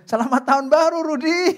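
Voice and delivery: high, loud sing-song greeting through a laugh; mock greeting. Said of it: "Kata Bro Yono".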